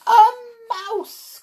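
high-pitched gasped-out exclamation; astonished cry. Acted. Witches reacting to the mouse plan, high thrilled cry. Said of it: "cried the witches".

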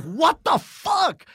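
high, loud, drawn-out disbelief; shocked outburst. Retold or imitated. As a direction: stretch it out in retold shock. Friend's shocked reply to confession.

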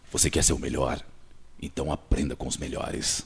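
booming low mentor voice; big deep voice. Retold or imitated. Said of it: "ele tinha um vozeirão assim".

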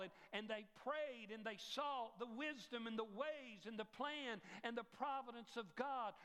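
a hint of an eager young boy; earnest boy's testimony. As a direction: tell it like a child explaining sincerely. Dead boy recounts his story.

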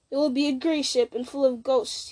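ordinary delivery

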